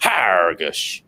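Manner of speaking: drawn-out pirate growl, falling low. Acted. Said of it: "Pirate [name]?".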